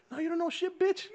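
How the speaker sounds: high-pitched mock outburst; acted angry mom. Mom snapping back at a teacher in the story, angry.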